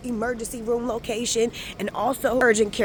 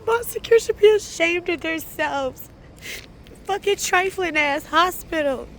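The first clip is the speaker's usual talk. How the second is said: high, upset, raised voice; tearful anger; 10 semitones higher, 5 dB louder than usual. Real, not acted. Shaming the hospital after hours of waiting, tearfully angry. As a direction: scold through real distress, pitched high.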